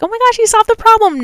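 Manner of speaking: high, quick mock cheer; imagined excited viewers. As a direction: mimic thrilled listeners in a high voice. Voicing viewers assuming success.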